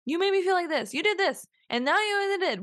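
high, accusing blame-game voice; mock blaming voice. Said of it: "In a way that is the blame game?".

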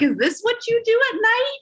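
high teasing husband voice; lightly voiced husband asking. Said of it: "and he's like".

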